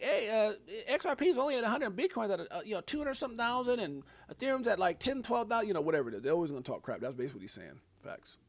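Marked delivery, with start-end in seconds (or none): 0.0-5.7 mock doubter. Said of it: "they'll be like".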